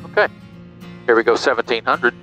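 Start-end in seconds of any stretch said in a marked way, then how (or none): none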